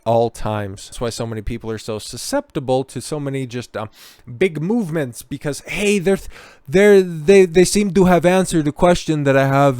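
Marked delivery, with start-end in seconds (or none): 5.6-9.8 louder, higher mock enthusiasm; eager follower. Host mimicking people susceptible to big movements, mock-eager. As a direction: imitate someone excitedly latching onto a movement.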